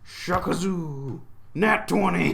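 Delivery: high, loud celebration of a lucky roll; triumphant shout. Real, not acted. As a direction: draw out the first word, then shout the result in triumph. Celebrating a natural twenty roll.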